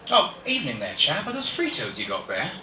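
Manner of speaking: British chap making small talk; lively mock-British greeting; British accent. Shown as a demonstration. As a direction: put on a chummy British accent. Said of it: "a British person's talking to you".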